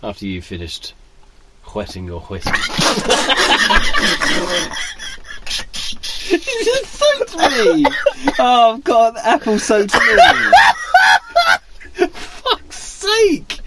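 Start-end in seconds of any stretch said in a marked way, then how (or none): 2.6-6.2 sputtering burst of laughter; spray and burst out laughing; spit take. Real, not acted. Spit take, apple juice sprayed. 6.3-13.6 shrieking through laughter; laughing shriek. Real, not acted. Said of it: "spitting out an entire mouthful".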